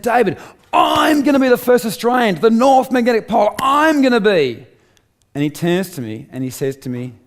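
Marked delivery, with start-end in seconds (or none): 0.0-4.7 high, loud declaration of the goal; defiant shout. Retold or imitated. Telling mentor David he'll reach the North Magnetic Pole, shouting. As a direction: shout it out, fully determined.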